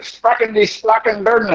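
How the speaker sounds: a bathroom attendant asking in fake German; mock German. Restroom attendant quizzing a confused tourist, mock German accent. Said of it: "in, in German".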